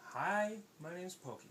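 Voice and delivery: slow, easy hello; unhurried, measured introduction; slow, careful greeting. Acted. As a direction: say it gently paced so nobody gets scared. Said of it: "I'm just gonna swim up real slow".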